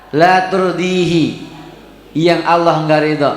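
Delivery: slow, measured recitation with long pauses. Acted. Teacher reciting Arabic to parents, slow measured recitation. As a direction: recite each phrase slowly, then translate it.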